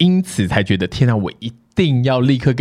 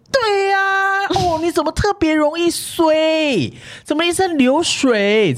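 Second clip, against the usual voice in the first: high-pitched, drawn-out gushing; imitating a coworker thrilled to find a scapegoat; mock overjoyed; 12 semitones higher, much slower than usual. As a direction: stretch it out high with exaggerated delight. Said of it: "整个喜出望外".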